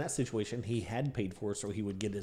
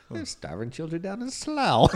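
put-on British accent; 8 semitones higher, 8 dB louder than usual. Mock British parent scolding.

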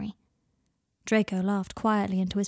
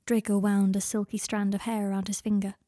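ordinary delivery

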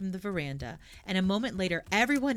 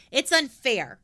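louder, indignant complaint; cross; 5 semitones higher, 7 dB louder, slower than usual. A narrator's reading. Pippi complains crossly to Tommy and Annika, louder. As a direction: protest hotly that it isn't fair. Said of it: "said Pippi crossly".

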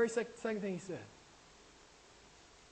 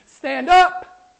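loud stand up; 5 semitones higher, 11 dB louder than usual. Shown as a demonstration. Preacher rallying the congregation, loud shout. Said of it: "it's not a passive thing".

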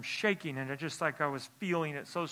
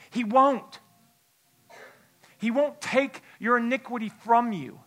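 raised, louder preaching voice; 6 semitones higher, 7 dB louder than usual. Acted. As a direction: drive the sermon home to the church. Preaching at full force.

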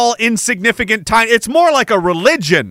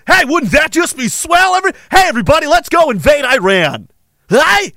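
mock cheer; 6 semitones higher than usual. Mocking warmongers calling everyone to invade Iran, sarcastic cheer.

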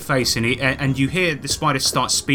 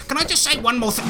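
dying spider plea; 10 semitones higher, much faster than usual. Acted. Spider cut in half begging to speak, high rushed plea. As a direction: beg in a rush before being cut down. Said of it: "and he goes".

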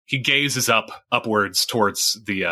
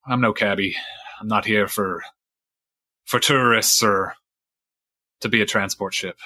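lightly voiced weathered fisherman recounting a grudge; a hint of salty sea-dog storyteller; old sailor Fisheye.

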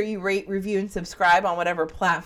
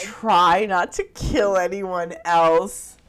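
slow mock imitation; 4 dB louder, much slower than usual. Host mimicking the killer's recorded line in a slow put-on voice. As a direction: imitate the killer's line slowly, half-strangled.

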